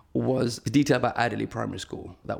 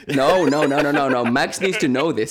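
raised, higher voice overriding an objection; loud insistence; 4 semitones higher, 7 dB louder than usual. Real, not acted. Storyteller overruling a teasing objection to his story, loud and insistent. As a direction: push back loudly and emphatically.

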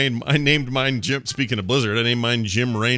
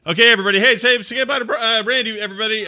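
big goodbye; 12 semitones higher, 6 dB louder, faster than usual. Acted. Host leads audience goodbye.

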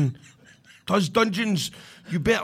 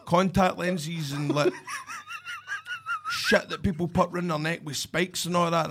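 usual speaking voice

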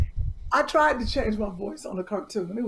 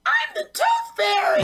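very high, drawn-out squeal; over-the-top high cartoon squeak; Tooth Fairy; slower than usual. Shown as a demonstration. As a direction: shout it slowly in a tiny high voice. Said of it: "I was doing the, um, Tooth Fairy".

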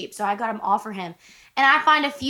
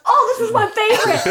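high, loud gush through laughter; imitated delight; 11 semitones higher, 5 dB louder than usual. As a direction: imitate a husband thrilled with his find. Retelling husband's delight at shirt.

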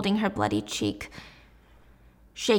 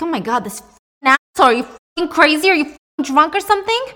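furious swearing; 7 semitones higher, 7 dB louder than usual. Retold or imitated. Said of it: "And Jay's like".

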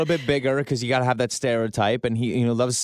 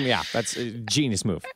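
usual speaking voice

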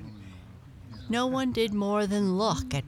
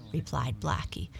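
ordinary delivery, nothing marked

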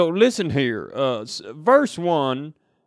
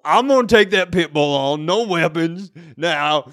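Foghorn Leghorn voice; 3 semitones higher, 6 dB louder than usual. Acted. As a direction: boom it out like a cartoon Southern rooster. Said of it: "You're going Foghorn Leghorn".

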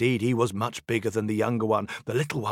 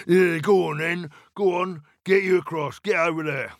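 louder impatient troll; gruff troll voice; 5 semitones higher, 5 dB louder than usual. Acted. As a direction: shoo the goat on gruffly. Troll waving goat on.

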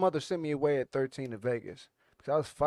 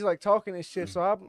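normal talking voice

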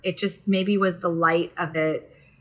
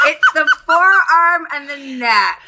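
mock scandalized; 7 semitones higher, 5 dB louder than usual. Continuing mock alarm.

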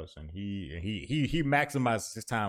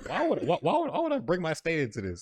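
laughing disbelief; 8 semitones higher than usual. Real, not acted.

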